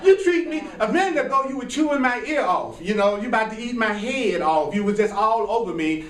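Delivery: hammed-up indignant lover; exasperated partner. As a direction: call out the partner who is hiding something. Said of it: "You're like".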